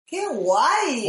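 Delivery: high, loud, drawn-out whoop of approval; thrilled, stretched-out exclamation; delighted cheer. Real, not acted. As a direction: swoop the pitch up and down with delight.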